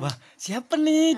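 high, slow curious wonder; drawn-out who's this; surprised. Retold or imitated.

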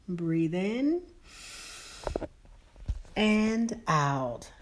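soft, calm meditation guide voice; slow and soothing, lead them inward; guided meditation. Acted.

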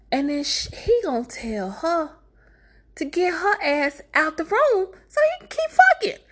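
very high, scandalized squeal; appalled disbelief. Real, not acted. Storyteller recapping the cheating scene, high scandalized disbelief. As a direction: voice shoots up in outrage.

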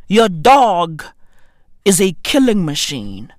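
ordinary delivery

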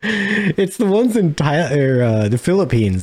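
normal talking voice

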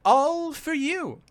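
high, slow showman voice; grand presenter voice. Acted. GM presents the machine.

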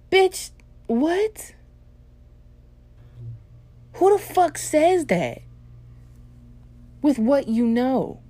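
high, loud, drawn-out disbelief; incredulous outrage. Real, not acted. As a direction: demand an answer as if you cannot believe it. Mock outrage at trying meth.

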